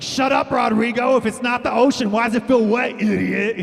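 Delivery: very high, loud squawking shout; high shrieking fish yelling at Rodrigo. Pretend. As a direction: scream it at dumb Rodrigo. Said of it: "It's like".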